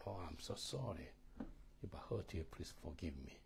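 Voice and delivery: a hint of a contrite husband; soft husband's apology. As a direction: say it gently, like a sorry husband.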